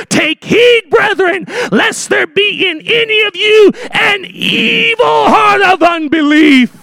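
high, slow, shouted scripture; shouted scripture quote; preaching at full force. Acted. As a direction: thunder the warning from the pulpit. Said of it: "would say it this way".

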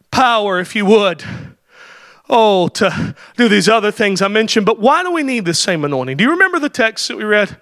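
ordinary delivery

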